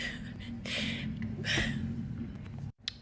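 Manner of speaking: whispered teary sob-laugh; sobbing laugh. Real, not acted. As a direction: break down laughing through tears. Overwhelmed, can't finish sentence.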